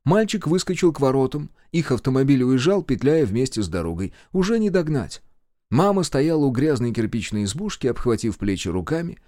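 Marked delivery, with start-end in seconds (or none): none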